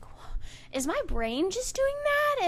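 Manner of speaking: high, slow, baffled question; retold bewilderment. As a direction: act out doubting your own brain. Acts out own bewildered reaction.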